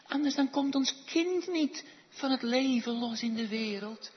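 high, urgent plea to God; pleading prayer. Acted. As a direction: beg the Lord, as if all depends on it. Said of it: "schreeuwt het uit tot God". (dringend smekend bidden)

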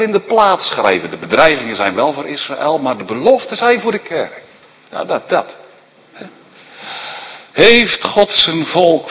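luid en hoger, preken op volle kracht; vurig preken. Acted. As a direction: preek het vurig de zaal in. Preacher at full force. (vurige preekstem)

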